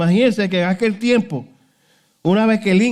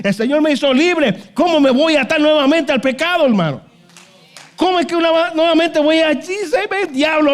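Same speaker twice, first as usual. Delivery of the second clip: preaching at full force; 6 semitones higher than usual. Acted. Preacher urging the church never to return to sin, fiery preaching. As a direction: thunder it at the congregation.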